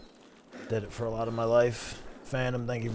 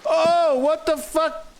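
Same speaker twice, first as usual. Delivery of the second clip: high startled outburst; shocked yell; 10 dB louder, slower than usual. Real, not acted. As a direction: blurt it out in sudden shock. Sudden shock in game.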